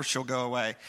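normal talking voice

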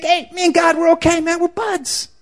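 high, breezy, too-chummy voice; casual dude voice. Retold or imitated. Preacher mocking a listener who thinks he's fine with God. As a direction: play it off like God's your pal. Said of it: "You may think".

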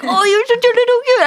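very high, gushing pet voice; squeaky-high ironic cooing; baby talk. Retold or imitated. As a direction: coo over the cat in mock adoration. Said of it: "baby talk to their dog".

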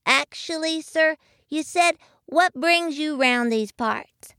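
put-on high, small child's voice; little voice. A narrator's reading. Young muskrat correcting the bear, little high voice. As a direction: pipe up as a polite little one. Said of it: "a little voice belonging to Jimmy Bobby".

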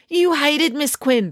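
high, slow, incredulous; pointed retort. A narrator's reading. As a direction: drag it out to call her bluff. Finn retorts.

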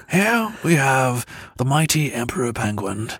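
hushed nature documentary narrator; David Attenborough narration. Retold or imitated. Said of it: "like David Attenborough".

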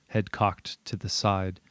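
ordinary delivery

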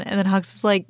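ordinary talk, nothing marked

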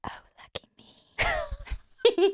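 giggly breathy whisper; laughing whisper. Pretend. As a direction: giggle through the words. Teasing reply, laughing whisper.

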